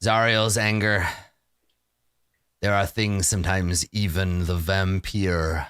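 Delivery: low, grave vampire lord; brooding vampire. Acted. DM voices a weary vampire to the party, low and grave.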